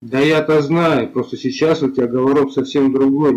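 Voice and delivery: ordinary delivery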